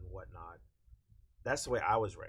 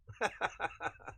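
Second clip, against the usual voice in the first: laughing; 9 semitones higher than usual. Real, not acted. Laughs at own remark.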